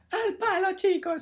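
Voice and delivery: high-pitched mimicry of an excited catchphrase; mock high-pitched shout to the lads; DJ Mario. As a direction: call it out in a squeaky-high imitation voice. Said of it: "le sale un poquillo la, la voz aguda".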